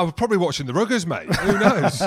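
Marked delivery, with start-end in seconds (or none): 1.4-2.0 a loud, high laughing "Who knows"; shrugging it off with a laugh. Real, not acted.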